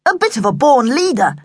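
self-important manly boast; manful boasting. A narrator's reading. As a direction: say it with chest stuck out. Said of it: "He stuck his chest out manfully".

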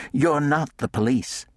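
a quick, sharp comeback, a touch of anger; snapped retort. A narrator's reading. Woman snapping at the watchman, a sharp comeback. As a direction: she cuts him off with it. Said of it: "she snapped".

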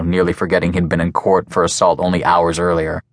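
ordinary delivery

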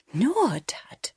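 voiceless frightened whisper. A narrator's reading. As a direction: breathe it out, barely voiced. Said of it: "she whispered".

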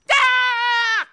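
loud, high scream of fright; startled scream. A narrator's reading. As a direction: scream as if grabbed from behind. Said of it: "Io urlai". (urlo acuto di spavento)

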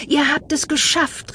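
high, bright relieved calling out. Acted. Friends greeting the returning pair, high relieved calling. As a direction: welcome them home with joy. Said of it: "riefen sie erleichtert".

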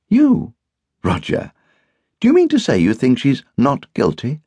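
high-pitched disbelieving challenge; incredulous. A narrator's reading. Hostess challenges Roger's doubt of guilt, incredulously.

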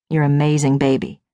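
low, pleased husband voice; Curtis, grinning. A narrator's reading. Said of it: "Curtis says, grinning like a possum".

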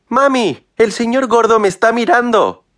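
put-on very high-pitched little-boy squeal; frightened child's voice. A narrator's reading. Small son telling mami about the neighbour, high childish voice. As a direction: call out like a scared small child. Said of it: "chilló con temblorosa voz infantil".

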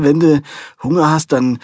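ordinary delivery, nothing marked

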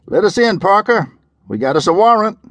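loud, high demand through a door; calling through the door. Acted. Deputy outside the duplex demanding entry, loud and high. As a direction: call out with a warrant to back you. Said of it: "the voice said".

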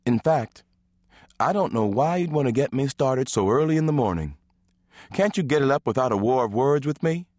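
teasing wife. Acted. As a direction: playfully needle her husband. Nola teases husband in morning.

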